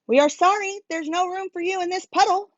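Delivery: high, light chirpy birds; lightly voiced little birds apologizing; birds' voice. A narrator's reading. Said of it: "said the birds".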